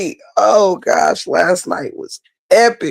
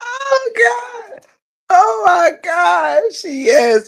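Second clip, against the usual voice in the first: crying laughing; 14 semitones higher, much slower than usual. Real, not acted. Recap punchline sets off laughter.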